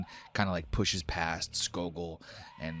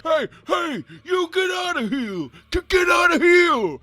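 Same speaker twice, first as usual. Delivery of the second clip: very high, booming shout getting louder; giant's furious yell; 12 dB louder than usual. Acted. Second snow giant charging in at the boys, yelling. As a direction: bellow them away at full force.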